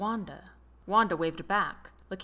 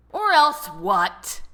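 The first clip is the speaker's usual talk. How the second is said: louder, slow fuming challenge; 4 semitones higher, 9 dB louder, much slower than usual. A narrator's reading. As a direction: push back, barely holding the anger. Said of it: "Robert fumed".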